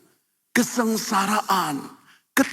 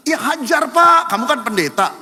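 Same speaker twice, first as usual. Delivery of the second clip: insisting neighbor; 5 semitones higher, 6 dB louder than usual. Retold or imitated.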